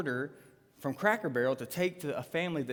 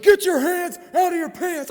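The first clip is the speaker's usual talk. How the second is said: loud, high mock police yell; shouted police order; 13 semitones higher, 13 dB louder than usual. Officers spotting hands in his pants, yelling the order. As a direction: shout it like a panicked cop.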